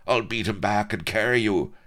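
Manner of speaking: loud, high rallying cry; moved cry. Acted. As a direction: urge your dying friend to hold on. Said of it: "cried the colonel".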